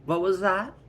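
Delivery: loud, high, rising demand; challenging wife. Acted. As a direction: call out sharply from overhearing. Mother overhears father's warning.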